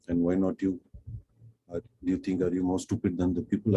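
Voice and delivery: normal talking voice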